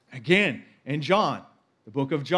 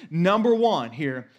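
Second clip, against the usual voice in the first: ordinary talk, nothing marked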